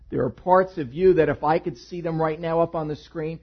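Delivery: ordinary talk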